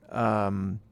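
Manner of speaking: ordinary delivery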